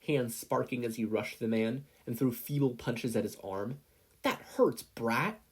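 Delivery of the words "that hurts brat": higher, louder angry snarl; a thug lashing out at the boy; yelled. A narrator's reading. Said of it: "the man yelled".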